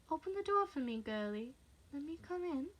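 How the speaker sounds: high, wheedling coax; sing-song menace, toying with her; creepy intruder asking sweetly; teasing. Acted. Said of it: "teasing now, playing with her".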